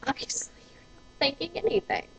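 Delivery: unvoiced whisper under the breath; whispered aside. Real, not acted. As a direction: drop to a sneering whisper. Muttered aside at other driver.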